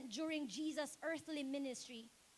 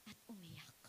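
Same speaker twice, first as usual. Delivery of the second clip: hushed, breathless whisper, much lower; weeping whisper; 11 semitones lower, 15 dB quieter than usual. A narrator's reading. Preacher whispering how Mary stayed and wept. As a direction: let it fall to a whisper.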